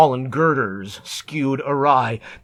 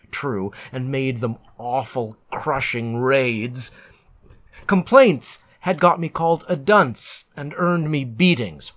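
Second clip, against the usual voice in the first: usual speaking voice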